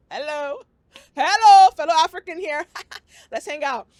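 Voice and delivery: loud, high excited greeting; excited call. Pretend. Greeting Lupita Nyong'o as a fellow African, loud and excited. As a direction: shout out to a celebrity, beaming.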